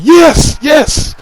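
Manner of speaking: high, loud cheering yes; thrilled shout of yes; excited yes. Retold or imitated. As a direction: shout it out like the catch just landed. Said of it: "like, excited in my voice".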